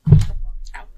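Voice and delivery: sharp yelp of pain; real cry of sudden pain; stubbed toe. Real, not acted. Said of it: "I stubbed my toe".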